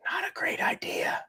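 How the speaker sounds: hushed rueful whisper. Real, not acted. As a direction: whisper it as a regretful aside. Rueful aside.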